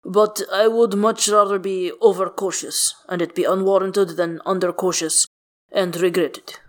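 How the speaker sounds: lightly voiced careful bodyguard; a hint of a formal, guarded figure; measured, cautious character.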